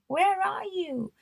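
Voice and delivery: high, pleading cry of a lost soul; anguished plea. Acted. The sinner in the fire crying out to God, a high pleading call.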